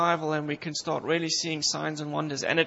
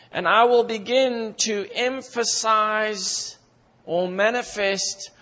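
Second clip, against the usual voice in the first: rising, louder, slower voice of Christ promising; Christ speaking; 3 semitones higher, 3 dB louder, slower than usual. Retold or imitated. Preacher voicing Christ's promise to believers, slow rising fervour. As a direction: build up as Christ, slow and fervent, growing higher and louder. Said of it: "Christ says".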